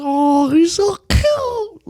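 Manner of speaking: high, slow cooing; melting at the cute dog; gushing. Real, not acted.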